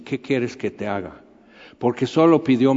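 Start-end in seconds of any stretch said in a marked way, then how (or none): 0.0-1.2 mucho más grave y suave, tierno; Jesús íntimo. Acted. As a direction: pregunta cercana, casi en confidencia. Jesús a solas con Bartimeo. (Jesús a solas con Bartimeo, suave y grave)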